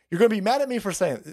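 ordinary talk

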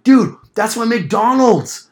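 high, loud, drawn-out holler; slow re-enacted shout of protest; retold yelling. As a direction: yell after someone walking away. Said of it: "I'm, like, yelling at him".

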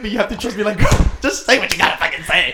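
high, loud mock fan. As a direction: parody the rambling fan.

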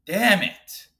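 annoyed at catching himself saying y'all; frustrated outburst. Real, not acted. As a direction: scold yourself for slipping up. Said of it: "I don't wanna start doing that".

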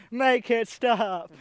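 long, drawn-out high shout; mock tortured wail. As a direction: wail it slowly like a comic torture victim. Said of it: "they're torturing him".

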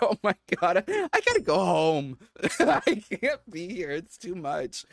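mock emotional overload, loud and high, easing off at the end; overwhelmed, laughing. Emotional wedding guest retelling wanting to flee, overwhelmed and laughing. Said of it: "And then I was emotional".

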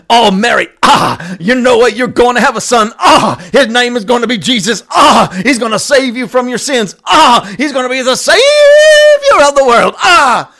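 hammed-up shouting revival preacher, high and loud; Gabriel. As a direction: announce it like a frenzied preacher, punctuated with "ah". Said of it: "can you imagine if Ga-, Gabriel showed up".

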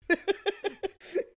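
high burst of laughter; a sudden, giggly high laugh. Real, not acted. Laughing at cop logic.

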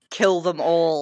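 slow, loud, hammed-up decree; playful death sentence, slow and loud; mock-ruthless command. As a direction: draw it out like a merciless order. Hammed-up command to kill every character.

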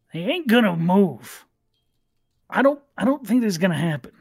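doubtful bugbear woman. Acted. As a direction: voice a sceptical bugbear crew member.

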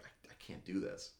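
soft, breathy acted plea; faint despair. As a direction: voice drops away as if giving up. Retells a man collapsing, unable to act.

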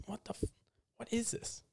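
hushed, unvoiced what is this; whispered disbelief. To listeners, recalling a rewatch, whispered disbelief. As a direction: whisper it like you can't believe it.

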